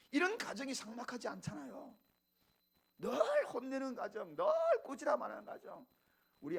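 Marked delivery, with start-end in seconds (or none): none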